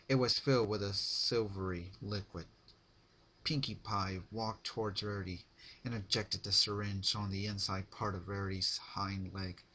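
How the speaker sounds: ordinary delivery, nothing marked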